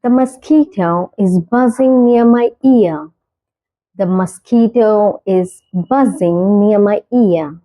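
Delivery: very slow, each word spaced out; slow clear example. Shown as a demonstration. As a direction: model the sentence slowly for learners. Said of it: "example sentence read slowly". (धीरे, साफ़ उदाहरण वाक्य)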